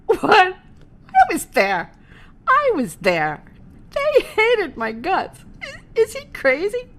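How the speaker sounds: high, loud, slow disbelief; stunned she could be praised; incredulous. Real, not acted. Teller disbelieving the promoter's praise, loud incredulous.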